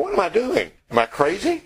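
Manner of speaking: high, startled self-questioning; panicked Peter. Retold or imitated. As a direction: Peter suddenly realizing he's on the water. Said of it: "starts looking around and going".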